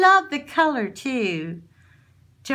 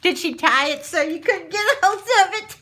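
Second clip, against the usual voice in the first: laughing; 7 semitones higher, 3 dB louder than usual. Real, not acted. Teasing dog with gift.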